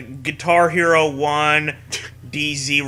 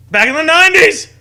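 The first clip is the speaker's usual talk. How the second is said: excited; 14 semitones higher, 9 dB louder, faster than usual. Acted. Excited joke about the nineties.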